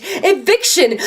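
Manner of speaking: laughing the word out bitterly. Acted. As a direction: spit it out with a harsh laugh.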